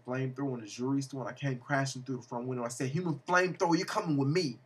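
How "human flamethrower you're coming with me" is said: raised-pitch superhero call to a villain; heroic challenge. Acted. Hero calls out to villain.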